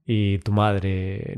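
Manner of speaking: ordinary delivery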